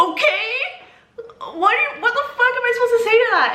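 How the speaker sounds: high-pitched, disbelieving comeback with a laugh; high incredulous storytelling voice; incredulous retort. Retold or imitated. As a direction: squeak it out, half laughing, totally thrown. Taken aback, retorting to Rick.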